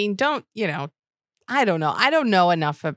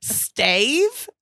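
confused Stave; 7 semitones higher than usual. Retold or imitated. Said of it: "hear him talk".

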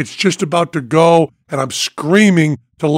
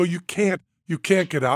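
softer, firm no from the crew; quiet refusal; 4 semitones higher, 8 dB quieter than usual. Retold or imitated. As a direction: astronauts calmly refusing, softly.

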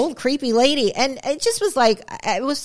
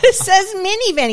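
put-on high, laughing kid voice; child insisting, laughing; 11 semitones higher, 4 dB louder than usual. Son insisting to dad, high pitched with a laugh. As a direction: squeak it out through laughter. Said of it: "son repeats".

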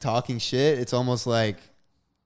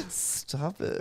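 embarrassed whisper; 11 dB quieter than usual. Real, not acted. Teased with pumpkin nickname.

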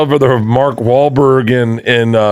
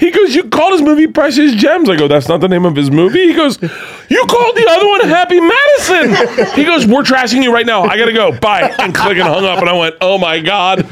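much higher, frantic; panicked retelling; 12 semitones higher than usual. Retold or imitated. Comedian reliving the phone call that made him panic, frantic and high. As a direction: rattle it off in a rising panic. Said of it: "I'm fucking panicking".

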